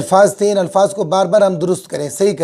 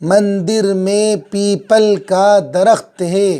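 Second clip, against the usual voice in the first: sentence read slowly, word by word again; unhurried classroom reading; slow repeat reading; much slower than usual. Shown as a demonstration. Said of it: "कई बार पढ़ेंगे इसको".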